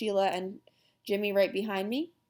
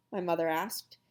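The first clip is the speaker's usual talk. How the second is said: ordinary delivery, nothing marked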